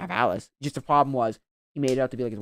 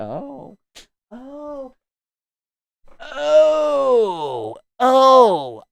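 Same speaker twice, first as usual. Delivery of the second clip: drawn-out fake sobbing moan; mock wailing; 7 semitones higher, much slower than usual.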